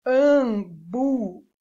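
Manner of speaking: "Ambu" loud and slow, "am" then "bu"; sounding out syllables. Shown as a demonstration. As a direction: punch out "Ambu" in two long syllables. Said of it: "Preste atenção na próxima sílaba". ("Ambu" forte e silabado)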